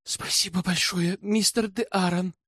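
put-on a young lady's understated rapture; delighted gasp. A narrator's reading. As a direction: thank him with a touch of breathless delight. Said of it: "восторженно ахнула Соли".

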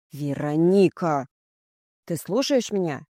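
understated growl of an irritated mother; growling displeasure. A narrator's reading. Mother annoyed by her daughter's silence, growling. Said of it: "прорычала недовольная моим молчанием мать".

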